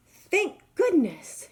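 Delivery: high, slow, drawn-out relief; relieved cry. Acted. Hornbill finding her egg unbroken, high relieved cry. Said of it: "she cried".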